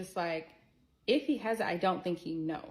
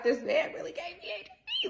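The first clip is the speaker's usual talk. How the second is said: shocked whisper, no voice; stunned whisper; faster than usual. Retold or imitated. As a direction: breathe it out in disbelief. Retold disbelief right after outburst.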